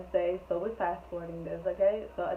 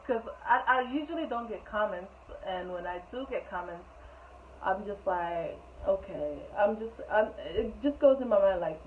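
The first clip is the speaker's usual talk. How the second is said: ordinary delivery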